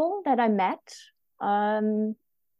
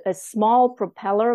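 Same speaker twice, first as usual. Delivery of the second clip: normal talking voice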